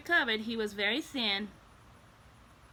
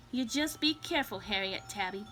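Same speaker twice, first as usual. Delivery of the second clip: usual speaking voice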